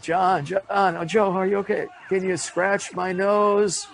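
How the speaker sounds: high strained plea, slowed at the end; quoted sketch voice. Retold or imitated. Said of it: "we still say that line".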